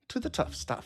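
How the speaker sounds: half-whispered under the breath, too soft to catch; mumbled. Real, not acted. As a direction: say it almost to yourself.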